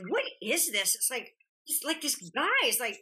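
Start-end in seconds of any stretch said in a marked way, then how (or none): none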